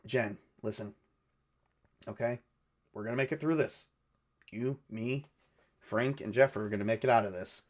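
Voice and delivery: soft, soothing reassurance; hushed, calming voice with gentle pauses; smooth-talking. Acted. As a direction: talk someone down quietly and steadily. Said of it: "smooth talk her down".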